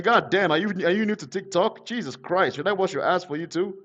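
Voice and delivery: ordinary talk, nothing marked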